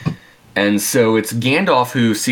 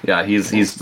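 normal talking voice

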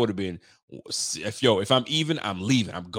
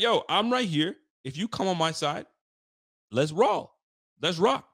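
higher-pitched fearless dare; confident challenge; 8 semitones higher than usual. Pretend. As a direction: puff up and invite the matchup. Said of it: "He was like".

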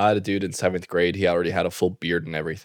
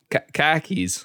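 high, r-less Boston "car keys"; Boston accent; 8 semitones higher, slower than usual. Shown as a demonstration. Host checking the phrase in Boston accent. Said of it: "did you say car keys or ca".

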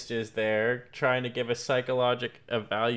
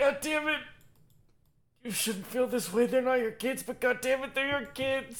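mock anguish; 11 semitones higher, 3 dB quieter than usual. Host voicing their own reaction.

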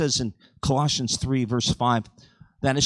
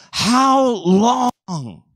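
crying out; 8 semitones higher, 6 dB louder than usual. Acted. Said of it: "the Lord cries out".

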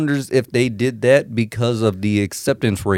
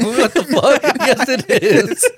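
laughing retold outburst; 14 semitones higher, slower than usual. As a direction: replay the friends' reaction while cracking up. Retelling reaction, laughing hard.

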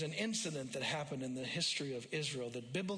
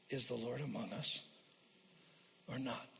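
lower, softer, halting lament; hushed doubting voice; 4 semitones lower, 7 dB quieter than usual. Acted. Pastor voicing a struggling believer's doubt, hushed and halting. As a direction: voice someone's quiet despair.

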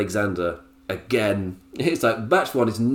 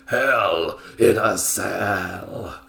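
very slow, stretched Hell in a Cell; slow, hammed-up match billing; drawn-out announcement; 5 semitones lower, much slower than usual. Shown as a demonstration. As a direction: draw each word right out for drama. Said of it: "He didn't say it like that".

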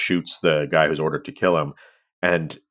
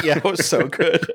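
high-pitched, laughing agreement; 9 semitones higher than usual. Real, not acted. Co-host cracking up at a favorite comic exchange, laughing. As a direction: laugh through the words, pitched up. Laughing at the co-host's quoted line.

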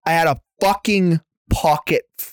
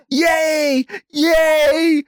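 imitating girlfriend's glee; 9 semitones higher than usual. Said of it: "my girlfriend has her".

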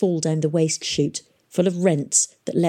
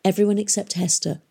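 plain reading voice

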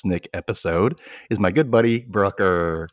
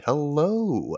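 imitating hello; 6 semitones higher, 3 dB quieter than usual. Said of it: "every time you say".

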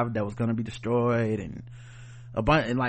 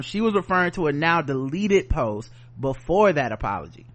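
ordinary talk, nothing marked

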